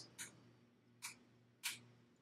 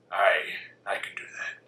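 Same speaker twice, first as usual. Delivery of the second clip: mumbled dwarf reply; 3 semitones lower, 6 dB louder than usual. Retold or imitated. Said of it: "And you can be like".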